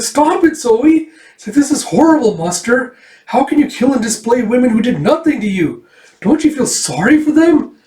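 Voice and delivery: very high, loud pleading; distraught girl. Acted. Erica begs Zoe and Muster to stop, very high and loud. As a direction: cry out in horror and protest.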